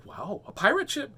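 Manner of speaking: put-on high-pitched awe from a thrilled child character; excited exclamation. A narrator's reading. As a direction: exclaim it in wonder. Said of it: "exclaimed Henry".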